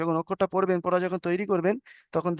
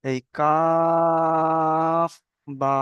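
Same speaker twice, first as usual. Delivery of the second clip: very slow recitation of letter names; drawn-out letter recitation; much slower than usual. Shown as a demonstration. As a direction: stretch each letter name long and sung. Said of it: "এইটাই দেখাচ্ছি".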